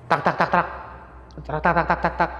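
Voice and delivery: mimicked door knocking sounds; imitated knocking. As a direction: tap out the banging.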